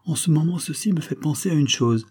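lightly acted sudden realisation; exclaiming. A narrator's reading. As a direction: raise the pitch as if just struck by a thought. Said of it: "s'écria le comte".

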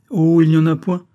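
loud, airy brush-off; dismissive. A narrator's reading. Floch brushing off his fiancée's worry, dismissive.

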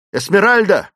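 loud, high urgent shout; shouting in her ear. A narrator's reading. Clayton shaking the unconscious Esmeralda, shouting in her ear. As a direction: shout the name to wake her. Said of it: "закричал он ей в ухо".

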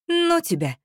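high, huffy brush-off; snorting dismissal. A narrator's reading. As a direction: huff it at him, very high. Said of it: "фыркнула я".

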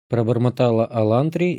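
ordinary delivery, nothing marked

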